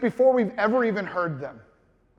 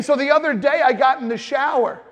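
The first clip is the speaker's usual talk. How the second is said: normal talking voice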